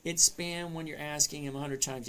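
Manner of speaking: ordinary delivery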